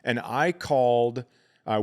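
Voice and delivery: ordinary talk, nothing marked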